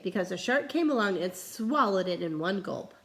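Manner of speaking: ordinary delivery, nothing marked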